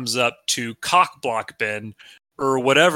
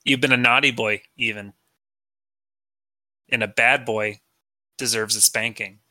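mock dominant Ben Shapiro; 3 semitones lower than usual. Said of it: "and he's like".

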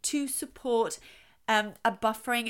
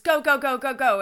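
rapid, louder, higher string of "go"; racing go-go; 4 semitones higher, 8 dB louder than usual. Acted. Cars in the story racing off, urgent. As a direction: rattle them off with building energy.

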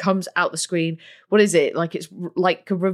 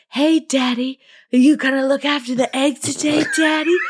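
imitating the sexy penguin; 6 semitones higher than usual. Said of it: "Sexy penguin".

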